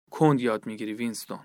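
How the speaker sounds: quiet, patient interrogator; gentle. A narrator's reading. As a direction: chide him gently, in a hushed low voice. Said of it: "اوبرین با ملایمت گفت".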